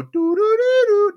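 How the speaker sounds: high loud imitated whistle toots; whistle imitation. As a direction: toot it like a wiener whistle. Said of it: "it'd go".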